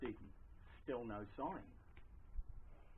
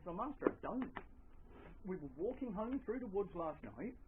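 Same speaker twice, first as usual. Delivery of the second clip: lightly voiced farmhand, pitched up; farmhand answering master; 7 semitones higher than usual. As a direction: report to the master in a higher voice. Farmhand answers his questioning master.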